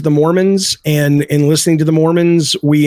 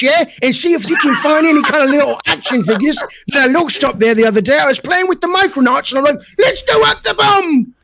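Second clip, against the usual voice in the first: a silly mock British accent, much higher; bad British accent; 10 semitones higher than usual. Jokes for a chat viewer in a hammed-up British accent. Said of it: "tell me how my British accent is".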